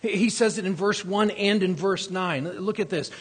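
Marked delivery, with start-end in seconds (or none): none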